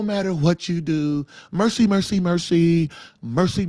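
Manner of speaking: slow, droning sanctimonious chant; mock pious churchman. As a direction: mimic a syrupy holy man, stretching every phrase. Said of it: "they talking like that".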